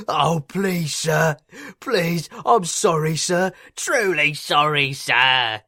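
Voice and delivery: loud, high, pleading grovel; grovelling pathetically. A narrator's reading. Controller begging the inspector for mercy, pathetic loud pleading. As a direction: beg on your knees, pitiful and loud. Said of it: "he grovelled pathetically".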